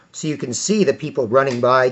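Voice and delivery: normal talking voice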